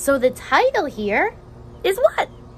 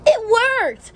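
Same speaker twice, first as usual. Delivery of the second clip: higher and louder, bright cheer; excited; 8 semitones higher, 4 dB louder than usual. A narrator's reading. Teacher reading to kids, excited cheer. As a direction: celebrate the turn. Happy story turn.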